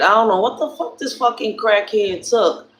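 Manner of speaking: ordinary delivery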